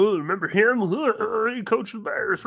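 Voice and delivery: hammed-up sportscaster nostalgia; gushing TV announcer. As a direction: lay it on thick like a fawning TV commentator. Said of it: "They're gonna be like".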